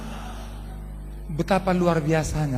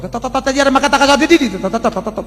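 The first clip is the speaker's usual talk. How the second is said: mock tongues; 10 semitones higher, 7 dB louder, faster than usual. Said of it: "berkata dalam bahasa roh".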